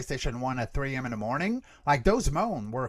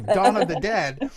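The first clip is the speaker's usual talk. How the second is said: slow loud call; 5 semitones higher, 7 dB louder than usual. Acted. Title repeated slower.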